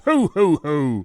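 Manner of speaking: loud booming Santa laugh; big jolly Father Christmas ho ho ho. Acted.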